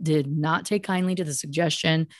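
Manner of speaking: ordinary talk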